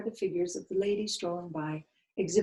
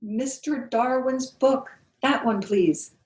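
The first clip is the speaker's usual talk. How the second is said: louder, slow and trembling; a mildly shaky, louder plea; shaking voice; 6 semitones higher, 8 dB louder, slower than usual. A narrator's reading. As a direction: force it out though scared. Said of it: "in a shaking voice".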